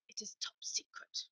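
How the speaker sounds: whispered, hushed and conspiratorial; secretive whisper. Retold or imitated. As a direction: lean in and whisper it like a state secret. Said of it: "he's Russian".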